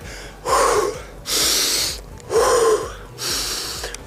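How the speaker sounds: long, audible blown-out breaths; exhaling through the mouth. Shown as a demonstration. Coach demonstrates getting rid of bad air, exhaling through the mouth. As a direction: blow the air slowly out of your mouth. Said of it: "out of your mouth".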